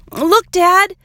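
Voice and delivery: high, loud excited call; excited child. A narrator's reading. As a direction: burst out to show Dad. Girl excitedly spots a puppy.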